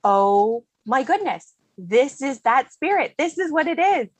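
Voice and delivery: slow, drawn-out astonishment climbing in pitch; amazed discovery. Retold or imitated. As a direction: act out a sudden, delighted recognition. Retold moment of discovery.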